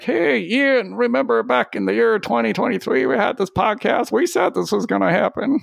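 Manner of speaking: put-on old man voice. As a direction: play it like a hammy old geezer. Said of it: "Is that your old man voice?".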